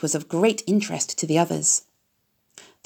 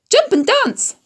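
very high crowing voice; rooster call; 3 dB louder, slower than usual. A narrator's reading. Rooster cheering Wilbur, high crowing call. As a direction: crow it like a rooster. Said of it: "said the rooster".

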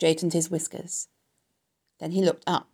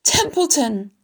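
high, loud appeal; desperate; 7 semitones higher, 5 dB louder, slower than usual. A narrator's reading. Wilbur appeals to the stubborn rat, desperate. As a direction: call the name pleadingly. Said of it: "said Wilbur in desperation".

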